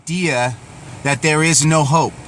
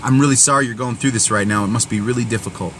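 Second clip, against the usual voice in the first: in kindness; 6 semitones lower, 4 dB quieter than usual. Retold or imitated. Speaking to a struggling friend about their pain, tender and pleading. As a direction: lower and softer, tender and pleading. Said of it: "respond in kindness and say".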